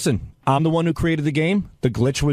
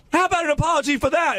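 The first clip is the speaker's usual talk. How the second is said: taunting demand; 10 semitones higher than usual. Retold or imitated. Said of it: "I go".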